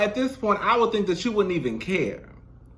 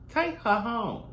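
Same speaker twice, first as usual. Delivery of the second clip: higher, fed-up repeat; exasperated; 4 semitones higher, 3 dB quieter, slower than usual. Real, not acted. Vlogger wants the girl gone, fed-up and higher. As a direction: throw it out like you've had enough.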